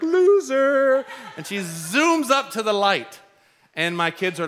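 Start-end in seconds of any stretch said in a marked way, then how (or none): 0.0-1.0 high, loud taunting "Loser!"; taunting shout. Retold or imitated. Wife zooming past husband, shouting a high taunt. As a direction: yell it out the window to tease. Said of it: "she's like".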